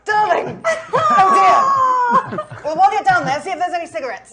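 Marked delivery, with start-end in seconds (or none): 0.0-2.4 mock delighted cry. Mock surprise at dog.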